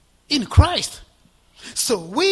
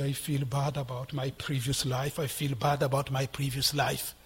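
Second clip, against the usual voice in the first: a hint of a downcast believer, softer and lower; voicing a guilty believer; 6 semitones lower, 4 dB quieter than usual. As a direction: mutter it like someone weighed down by shame. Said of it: "You say".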